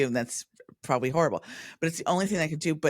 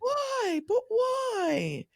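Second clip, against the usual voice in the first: high, wailing British mimicry; plaintive high-pitched British impression; parroted British wail; much slower than usual. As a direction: squeal it in a mock British accent. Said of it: "a parrot".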